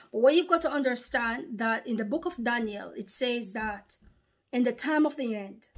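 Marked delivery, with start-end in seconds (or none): none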